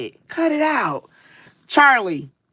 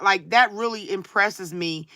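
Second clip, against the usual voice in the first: ordinary talk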